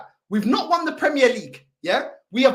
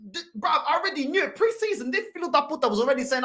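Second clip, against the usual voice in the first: usual speaking voice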